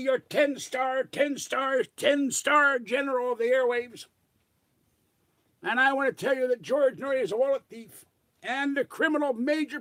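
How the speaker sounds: high, loud blustering self-styled general; prank call as J C. Pretend. Prank caller to radio host, high pompous general voice. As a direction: puff up as a pompous ten-star general prank-calling. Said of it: "call up as J C".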